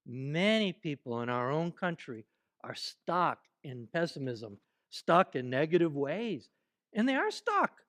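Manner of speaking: ordinary delivery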